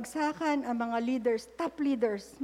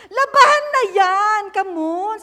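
loud, high mock parent command; parent ordering chores; 7 semitones higher, 11 dB louder, slower than usual. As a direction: bark it like a nagging parent. (ginagayang utos ng magulang)

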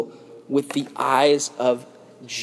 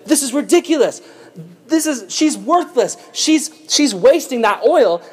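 higher, scornful mock Judas; indignant Judas; 5 semitones higher than usual. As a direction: sneer at the wasted oil, a bit raised. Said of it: "he looked and said".

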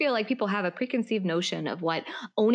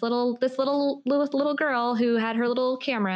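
mocking little girl; 5 semitones higher than usual. Mocking how he saw her.

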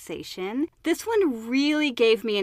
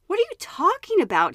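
exclaimed; 9 semitones higher than usual. Retold or imitated. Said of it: "he exclaimed".

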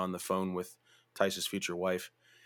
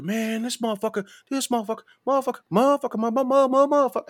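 much higher, stammering mock fury; mock sputtering rage; 6 dB louder, faster than usual. Angry guy in fake Jordans sputtering at the narrator, mock stammering rage. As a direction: sputter like a guy too mad to finish the word.

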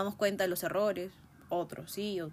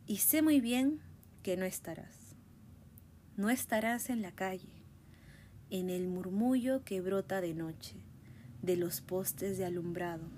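recita despacio y con pausas; lectura de poema; 2 semitones lower, 4 dB quieter, slower than usual. Acted. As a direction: lee el poema con calma y cuidado. Reads Cortázar poem aloud. (recitando a Cortázar con pausas)

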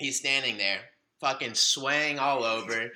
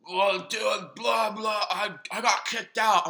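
lightly voiced drunk guy, pitched up; a hint of drunken rambling, higher; drunk friend; 8 semitones higher than usual. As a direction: imitate a drunk buddy on the phone. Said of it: "Mike's drunk".

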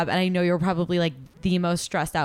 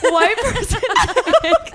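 cracking up mid-line; 13 semitones higher, 5 dB louder than usual. Real, not acted. Joking, cracking up.

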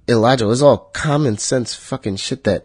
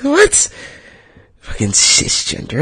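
mock disgusted laugh. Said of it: "and laugh back in disgust".

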